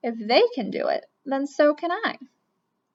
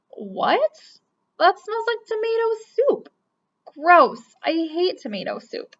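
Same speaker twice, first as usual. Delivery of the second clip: disgusted; 7 semitones higher, 3 dB louder, slower than usual. Acted. Kid disgusted by tomato soup.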